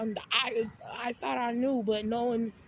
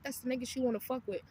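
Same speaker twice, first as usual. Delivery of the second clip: ordinary talk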